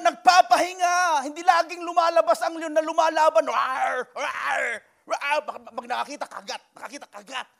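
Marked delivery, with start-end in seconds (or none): 3.5-5.4 lion roar. Pretend. Lion imitation.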